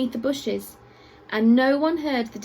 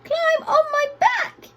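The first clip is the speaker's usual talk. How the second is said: much higher, slow squeaky giraffe; high squeak; 3 dB louder, slower than usual. A narrator's reading. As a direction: squeak the offer gently but urgently. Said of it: "he squeaked in his rather high voice".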